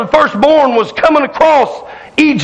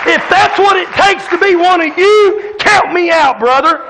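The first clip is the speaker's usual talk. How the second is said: fiery preaching; 4 semitones higher than usual. Acted. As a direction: call it out to the church. Preaching at full force.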